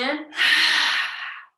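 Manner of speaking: loud rush of breath out; forceful pumped exhale. Shown as a demonstration. Yoga teacher demonstrating breath of fire slowly, forceful exhale. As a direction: push all the air out hard. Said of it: "pull in".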